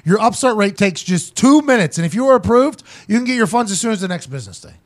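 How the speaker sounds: upbeat podcast ad read. Acted. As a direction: sell it like a host ad. Reads a sponsor ad.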